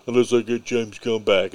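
lightly put-on voice of an actor's ultimatum; a hint of mock protest; Dave Bautista. Podcaster retelling actor's stand for director, lightly mocking put-on voice. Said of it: "He did the whole thing, you know, like".

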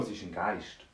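plain reading voice

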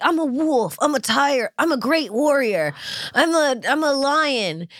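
high, grand proud declarations; mock boastful warriors. Friends bragging about their tarot animals, mock grand and high. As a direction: puff up like a proud warrior, slowing on the last. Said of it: "Everyone was like".

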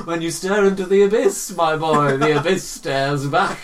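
loud, hammed-up sage; mock-profound proclamation. Quoting the abyss to my boy, mock-profound. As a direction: declaim it grandly, slowing at the end. Mock-philosophical quote to friend.

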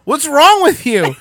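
high, loud, slow imitation; mock his loud, high disbelief; shocked old man. Old man appalled by the threat, loud mock-shocked question. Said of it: "And he goes".

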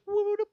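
high swooping whoops; light wasp flight noise; imitated whoop. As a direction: whoop like something zipping through. Imitates wasp slipping through hole.

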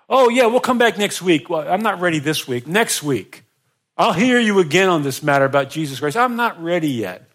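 high, loud breezy brush-off; putting-off excuse. Pretend. Preacher voicing a listener who keeps putting God off. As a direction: wave it off till next week. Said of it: "If you're in that place today".

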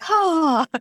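mock dreamy sigh of delight; swooning ah. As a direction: melt at the sound of the accent. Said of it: "I'm just like".

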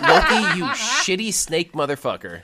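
high, loud laughing mock outrage at Loki; a friend laughing as she curses the screen villain; laughing insult. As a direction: spit the insult out through laughter.

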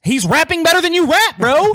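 emphatic shout. Real, not acted. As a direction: hammer the point home at him. Heated argument over rap.

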